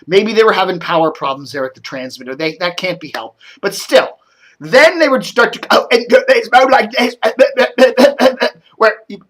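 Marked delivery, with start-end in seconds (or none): 5.7-7.1 choppy audio. Retold or imitated. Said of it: "the audio would be coming out in chunks like that".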